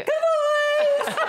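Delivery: high, loud call across a field; alpaca calling voice. Shown as a demonstration. Alpaca owner calling her herd across the field, high loud call. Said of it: "Do you have a voice?".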